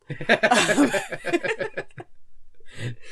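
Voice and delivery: big loud laugh, falling away; hearty real laugh; loud burst of laughter. Real, not acted. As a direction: crack up loudly at the tease. Laughs at being teased.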